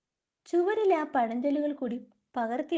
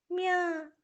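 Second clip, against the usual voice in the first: a high little mew like a cat; 5 semitones higher, much slower than usual. Acted. Character answering only in a high cat mew. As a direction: mew it out like a kitten crying. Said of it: "ഇവനെന്താ പൂച്ചയെ പോലെ കരയുന്നത്".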